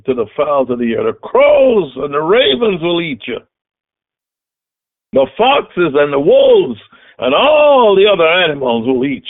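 fiery preaching. Acted. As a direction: thunder the threats from the pulpit. Preaching David's threat at full force.

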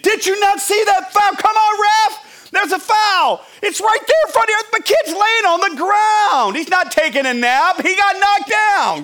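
high, loud shouted protest; very animated coach. Acted. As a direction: yell at the ref in outrage. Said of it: "I'm getting very animated".

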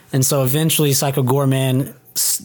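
ordinary talk

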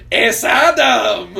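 loud, high, drawn-out mock reading; hammed-up teacher reading Spanish aloud; teacher's booming reading. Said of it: "that was how he said it".

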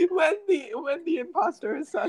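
high laugh breaking into words; cracking up. Real, not acted. Giggle fit over sus joke.

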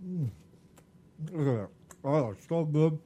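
talking through a mouthful; chewing while talking; mouth full. Real, not acted. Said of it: "Talking with our mouth full".

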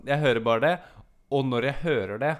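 normal talking voice

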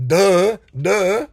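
loud scornful duh; mocking duh. Real, not acted. Mocking people who complain, loud sneering duh. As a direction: sneer it at the obvious.

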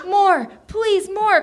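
acted desperate plea; pleading cry. A narrator's reading. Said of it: "cried Tony".

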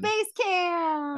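punchy show-title announcer call. Acted. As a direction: announce the show name up high. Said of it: "I love how you just say that".

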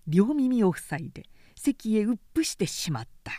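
ordinary delivery, nothing marked

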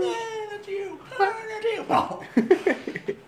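high squealing laugh through the words; laughing mock voice. Mocking friend, high laughing squeal. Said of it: "I went".

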